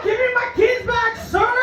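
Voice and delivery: high-pitched plea to an intruder; mock panicked demand. Mom to the man in her house, mock panic. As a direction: plead in a hammed-up high voice.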